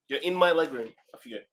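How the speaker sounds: usual speaking voice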